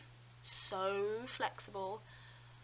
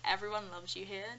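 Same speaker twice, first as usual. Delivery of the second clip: usual speaking voice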